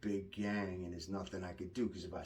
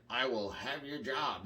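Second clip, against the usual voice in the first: lightly mocked old-man talk, pitched up; mockingly stiff, like one old man to another; a hint of a stilted old man; 4 semitones higher, 3 dB louder than usual. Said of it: "That's like an old man talking".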